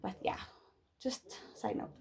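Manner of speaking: whispering. Real, not acted. Whispered aside.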